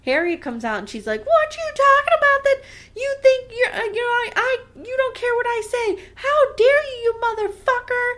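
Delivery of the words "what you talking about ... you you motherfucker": very high, hammed-up screechy outrage; trashy tirade. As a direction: play an outraged wife trashing it up. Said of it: "trash it up a little bit".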